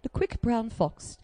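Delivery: high, soft, really clipped royal English accent; slow, clipped Queen's English, swallowing the words. Shown as a demonstration. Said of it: "it's the Queen's English".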